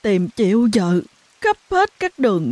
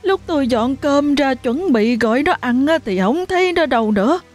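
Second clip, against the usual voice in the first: distraught mother; 7 semitones higher than usual. A narrator's reading. Mother pleading with neighbour about missing daughter, distraught. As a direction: beg for help, voice breaking.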